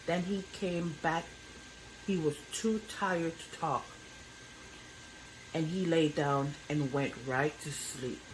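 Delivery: usual speaking voice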